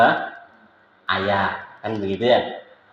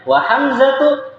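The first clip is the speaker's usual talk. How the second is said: Wa hamzatu, drawn out high with a comma pause; pause after hamzatu; 12 semitones higher, slower than usual. Shown as a demonstration. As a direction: stretch Wa hamzatu slowly and stop after it. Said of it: "maka ada yang namanya koma di situ".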